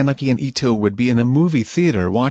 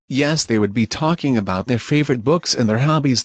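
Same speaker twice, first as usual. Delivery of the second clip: ordinary delivery, nothing marked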